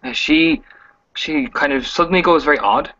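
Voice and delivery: usual speaking voice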